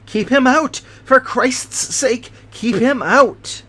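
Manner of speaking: panicked dying shriek; terrified yell. Acted. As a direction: scream it at something in the window. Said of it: "he yelled in a voice which I could never forget".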